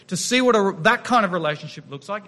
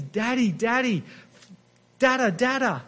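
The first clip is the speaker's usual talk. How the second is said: put-on little child calling; 3 semitones higher than usual. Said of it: "Believe me, it's".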